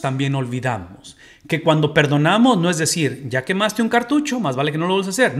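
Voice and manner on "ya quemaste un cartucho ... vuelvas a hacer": higher mock scolding. Cita imitada de perdón condicional.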